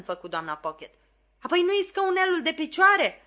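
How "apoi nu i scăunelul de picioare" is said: loud high exasperated cry; shout. A narrator's reading. Flopson shouting at Mrs Pocket about the footstool, exasperated. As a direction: shout it at her mistress. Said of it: "strigă Flopson".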